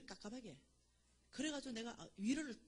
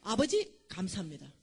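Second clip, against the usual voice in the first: loud proclamation to the Father; loud declared thanks to God; declaring aloud; 10 dB louder than usual. Retold or imitated. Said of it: "선포를 해서".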